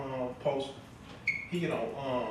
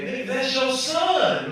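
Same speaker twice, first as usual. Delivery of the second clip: outraged punchline; 8 semitones higher, 7 dB louder, slower than usual. Retold or imitated. Comic's punchline outburst.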